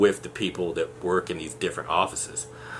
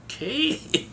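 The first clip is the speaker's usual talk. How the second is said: okay, jumping way up and rising; a startled, squeaky little okay; mock-meek okay, pitched way up; meek high okay. Meek reply to the rant.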